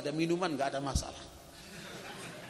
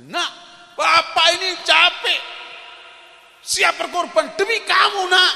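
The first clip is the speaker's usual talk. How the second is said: higher, louder, slow self-pitying cry; complaining father; 11 semitones higher, 12 dB louder than usual. Retold or imitated. Father telling his children how tired he is, slow loud lament. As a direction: lay on the sacrifice like a weary father.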